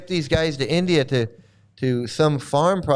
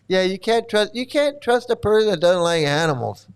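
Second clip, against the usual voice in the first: ordinary talk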